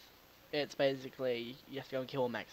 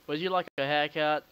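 laughing taunt; 9 dB louder than usual. Pretend. Taunting zombie with scissors.

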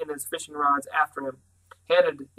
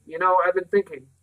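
ordinary delivery, nothing marked

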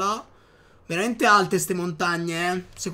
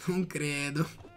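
laughing; 4 semitones lower, 8 dB quieter, slower than usual. Real, not acted.

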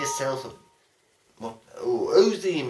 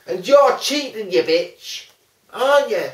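lightly voiced donkey bray, high; a mock donkey voice, loud and high; Dave the donkey; 7 semitones higher, 7 dB louder than usual. Playing Dave the donkey for the friend, a mock donkey voice. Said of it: "That didn't even sound like Dave the donkey".

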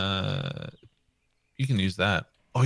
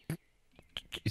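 whispering; 14 dB quieter than usual. Real, not acted. Whispering while sneaking.

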